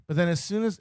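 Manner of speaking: usual speaking voice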